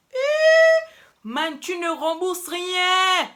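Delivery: high, loud outraged cry, then slow; outraged friend. Retold or imitated. Friend refusing to let her repay the drink, high outraged cry. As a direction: shriek it in disbelief, then drag it out. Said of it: "Elle fait".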